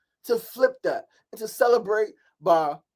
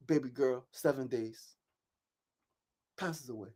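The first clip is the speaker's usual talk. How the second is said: grieving softly; 6 semitones lower, 13 dB quieter than usual. Real, not acted. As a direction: voice sinking low and soft with grief. Baby daughter dies after seven days.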